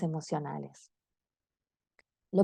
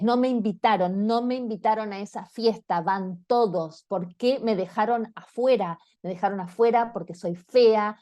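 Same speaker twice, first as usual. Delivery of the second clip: put-on angry teenage daughter. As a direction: play a hurt, furious sixteen-year-old. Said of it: "una hija de dieciséis años ... estoy muy enojada".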